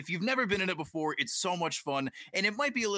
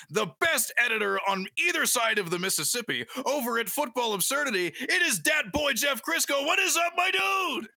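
hype announcer intro; 7 semitones higher than usual. Acted. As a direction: announce him like a boxing champ.

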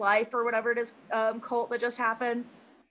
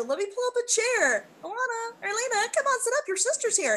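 high, sweet coaxing voice; coaxing Carl; 10 semitones higher than usual. Retold or imitated. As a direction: coo politely like a host. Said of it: "Carl has her like".

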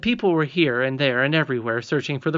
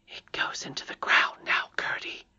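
whispered princess voice; barely voiced, secretive warning; hushed whisper; 4 dB quieter than usual. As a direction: whisper it to your companion. Irene stops, hearing goblins near.

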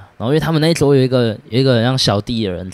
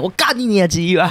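higher-pitched fake tough-guy threat; mock threatening yell; 7 semitones higher than usual. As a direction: play at scaring them off. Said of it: "在那边吓他们". (假装凶狠地吼)

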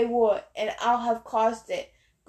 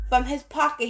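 ordinary delivery, nothing marked

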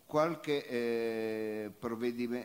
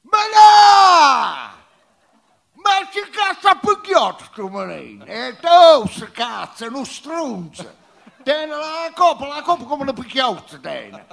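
furious shouted dialect, much higher and louder; neapolitan street slang; 9 semitones higher, 14 dB louder than usual. Acted. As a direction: scream it like a street brawl. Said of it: "Così in lingua pugliese".

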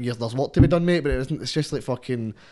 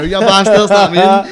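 higher, much louder jeering insult; mock shout; 7 semitones higher, 14 dB louder than usual. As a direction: fling it at them like a jeer. Cursing at old employer, mock shout.

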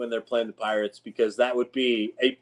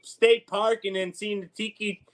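higher hammed-up yinzer voice; mock Pittsburgh yinzer; 4 semitones higher than usual. Cohost joining the bit, mock yinzer accent. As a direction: boast like a Pittsburgh local.

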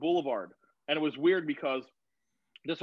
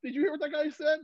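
shocked disbelief; 13 semitones higher than usual. Retold or imitated. Telling a friend about the stranger's words, in high stunned disbelief. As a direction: squeal it like you can't believe your ears. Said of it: "I'm like".

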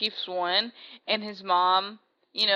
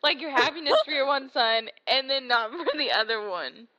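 crying; 6 semitones higher, 4 dB louder than usual. Real, not acted. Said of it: "you're crying just talking about it".

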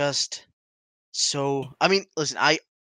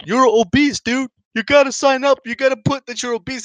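higher, louder pushy dad voice; imitating dad; 8 semitones higher, 4 dB louder than usual. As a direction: push him like his dad did. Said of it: "he's like".

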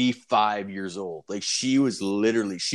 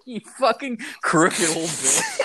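mock outrage; 8 semitones higher than usual. Mock insult, amazed.

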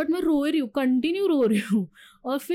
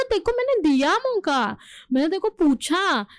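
higher and louder, an angry retold retort; 5 semitones higher, 4 dB louder than usual. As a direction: throw the line back at him, higher and louder. Retold shouting at boyfriend.

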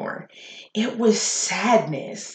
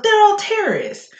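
higher, mocking coworker voice; mocking bigot; 12 semitones higher than usual. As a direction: parody his smug claim, pitched up. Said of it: "And he was like".

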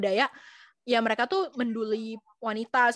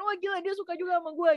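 high, fast excited inner voice; giddy disbelief; 6 semitones higher, faster than usual. Retold or imitated. Her thinking her crush likes her back, giddy disbelief. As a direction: rush it out in smitten amazement.

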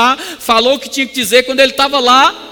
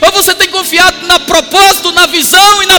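high, loud, driving sermon voice; impassioned preacher at the peak of a sermon; full-force preaching; 5 semitones higher, 5 dB louder than usual. Real, not acted. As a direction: press every phrase on the congregation at a near shout.

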